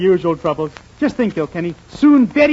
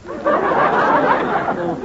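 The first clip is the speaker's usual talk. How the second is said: high laughing "well". Acted. Laughing off the baker's silly offer, high laugh.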